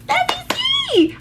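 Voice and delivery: sudden squeaky high pitch. Retold or imitated. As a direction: jump way up into a squeak. Repeats phrase, second squeaky high.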